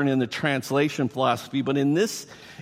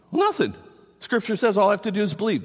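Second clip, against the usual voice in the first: high-pitched, glib mock reply; quick, high voice of an excuse-maker; complacent believer; 6 semitones higher, faster than usual. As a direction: imitate a breezy believer, pitched up.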